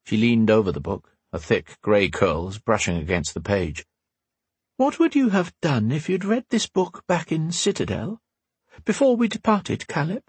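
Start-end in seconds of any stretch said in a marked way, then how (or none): 4.8-10.2 lightly voiced older woman, higher; a hint of a wise old teacher; Windy's voice. A narrator's reading.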